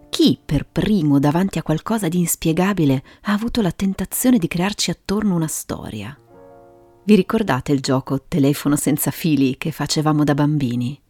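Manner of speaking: ordinary delivery, nothing marked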